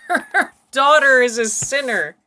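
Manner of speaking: high, loud words through laughter; giggling disbelief. Real, not acted. Host echoing the guest's sinner remark, giggling. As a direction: laugh your way into an incredulous repeat. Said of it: "I can't stop giggling".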